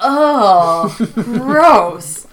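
drawn-out disgust with a laugh. Real, not acted. Host recoiling at Andy saying it, amused disgust.

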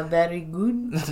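low, soft put-on French accent; mock French accent. Mimicking the concierge for a co-host, French accent. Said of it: "I love her accent".

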